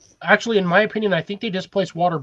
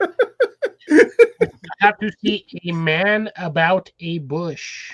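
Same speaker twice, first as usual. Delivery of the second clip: every word spaced out slowly; slow, careful; 3 dB louder, slower than usual. Shown as a demonstration. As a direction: spell it out word by word. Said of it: "the way you said it".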